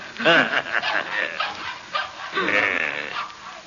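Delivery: long, gloating madman's laugh; maniacal laugh. Acted. Madman boasts of killing to his captive, maniacal laughter. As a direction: laugh on and on with sick delight.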